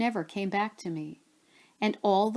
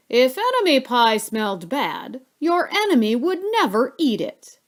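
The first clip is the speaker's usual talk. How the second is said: louder, higher knowing dad; dad's voice; 4 semitones higher, 6 dB louder than usual. A narrator's reading. Narrator as dad explaining to his son, louder and higher. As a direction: explain it like it's obvious.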